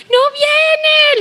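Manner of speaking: quejido muy agudo y alargado; lamento desesperado exagerado. Retold or imitated. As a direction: lloriquear con voz aguda, en broma. Retold wailing reply to husband. (queja llorosa y aguda, imitada)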